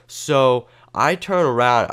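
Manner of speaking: ordinary talk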